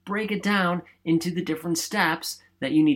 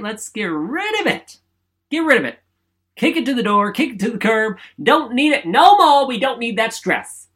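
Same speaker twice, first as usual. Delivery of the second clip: shouted higher and louder, pumping the crowd; rally them to kick stress out; hyped-up rallying; 7 semitones higher, 7 dB louder than usual. Pretend. Host pumping up viewers to ditch stress, loud and hyped.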